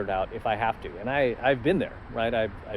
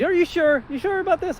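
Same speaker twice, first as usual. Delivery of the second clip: much higher fretting father; doubting dad; 4 dB louder than usual. Retold or imitated. Dad asking his son a third time, much higher. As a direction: press him again like an anxious parent. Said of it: "He asked me, like, three times".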